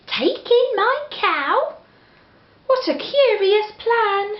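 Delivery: put-on high, pleading little old lady; a fussing old lady asking for help. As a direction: beg the wise man in a high, fretful voice.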